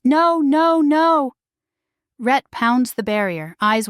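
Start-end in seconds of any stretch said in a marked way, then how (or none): 0.0-1.3 high, loud desperate scream. Acted. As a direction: scream it in panic. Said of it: "Sean screams".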